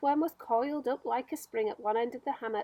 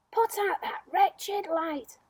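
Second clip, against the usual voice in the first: slow, higher furious order; angry shout; 7 semitones higher, slower than usual. Acted. Centipede shouting at the glowworm while throwing a boot, slow and angry. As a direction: hurl the words with the boot. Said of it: "he shouted".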